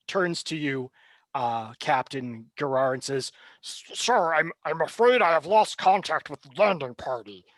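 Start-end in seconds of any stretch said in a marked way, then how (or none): 3.6-7.5 stammering, anxious report; a flustered officer with bad news; nervous officer.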